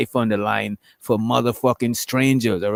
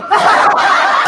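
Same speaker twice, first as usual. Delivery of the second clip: sudden high burst of laughter; a real burst of laughter at a friend's joke; loud laugh; 7 dB louder than usual. Real, not acted. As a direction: crack up out loud.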